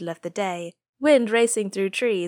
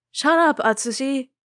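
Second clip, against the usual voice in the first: loud, high retort; yelling back; 7 semitones higher, 6 dB louder than usual. A narrator's reading. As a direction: snap back with a shout. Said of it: "Nishimura yells back".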